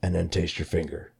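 low, soft menacing game-master voice; sinister puppet voice. Retold or imitated. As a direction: read the rules like the Saw puppet. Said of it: "it's gonna be like".

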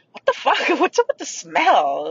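sudden jump up in pitch, then a laugh; mock shock at the stink; retold startled outburst. Blurting it out in the grocery line, high and startled.

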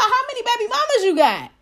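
very high, fast acted disbelief; retold incredulous squeal; astonished question. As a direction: blurt it in mock shock.

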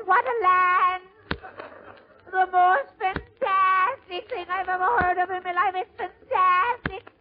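very high, awestruck Irish voice; Irish immigrant's brogue. Acted. Irish newcomer amazed by the streetcar conductor, high Irish accent. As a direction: marvel in a high Irish singsong, drawing out the last word. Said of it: "this is an Irish story".

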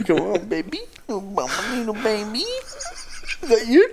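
put-on baby voice. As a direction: coo it like baby talk. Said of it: "did you used to do the baby voice?".

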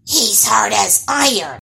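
loud, grumbling creature voice; forceful monster whine; complaining troll daughter. A narrator's reading. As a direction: complain like a disgruntled young troll. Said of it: "complained the troll daughter".

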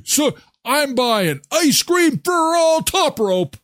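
hyped-up frenzy; getting carried away, pumped; energy drink ad. Real, not acted. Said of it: "Okay, that's enough. Settle".

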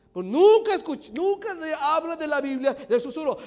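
high, very loud exhortation; full-force preaching. Real, not acted. Preacher insisting intimate words stay private, shouted. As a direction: drive it home at full volume.

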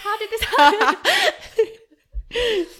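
loud, high, drawn-out teary laugh; laughing through tears. Real, not acted. Guest crying on the podcast in disbelief, laughing tearfully. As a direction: wail it slowly through real laughter. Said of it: "I'm crying on the podcast".

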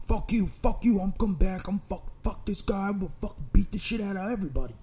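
high, sputtering mock tirade; ranting threats. Attacker vows to return and beat everybody, high sputtering rant. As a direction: imitate a worked-up man stumbling over threats.

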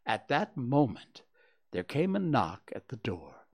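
usual speaking voice

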